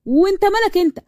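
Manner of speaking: brazen retort, starting high; insolent. Acted. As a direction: snap back impudently at an elder. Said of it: "سارة ببجاحة".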